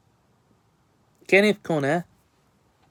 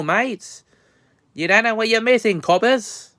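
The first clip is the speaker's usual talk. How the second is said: fake Aussie accent; 4 semitones higher than usual. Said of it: "worst fake Aussie accent".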